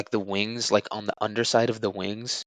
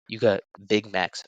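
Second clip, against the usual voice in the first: ordinary delivery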